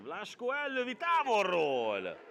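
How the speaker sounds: slow, lifted shot call; excited sports commentary. Acted. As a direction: draw out the shot. Long shot called live.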